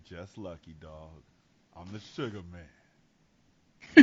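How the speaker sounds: low, hushed, slow smooth-operator voice; Sugar Man's deep voice. Acted. Robinson brushes off the cop, in a deep voice. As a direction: drop way down, soft and drawn out. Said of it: "Like his voice got deeper".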